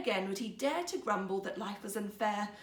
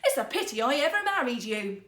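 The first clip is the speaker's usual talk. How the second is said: louder, harsh and furious; harsh insults; 2 semitones higher, 8 dB louder than usual. A narrator's reading. Wife insulting her husband, harsh anger. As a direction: spit out the insults. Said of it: "her harsh words".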